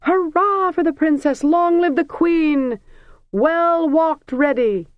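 high, loud, drawn-out cheers; crowd cheering. A narrator's reading. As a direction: hail her like a jubilant crowd. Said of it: "the crowds burst out".

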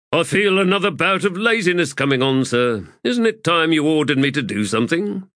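lightly voiced provocation; Pors, goading.

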